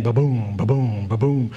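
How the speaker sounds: low, booming ba-boom; imitated bomb blasts. Marine retelling bombs hitting the ground, low imitated booms.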